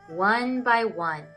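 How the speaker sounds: ordinary delivery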